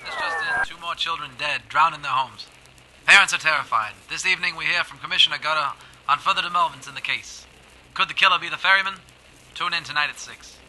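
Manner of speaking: brisk radio newsreader delivery; news bulletin. Acted. Newsreader breaking the child deaths story on air. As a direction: read it like an urgent news flash.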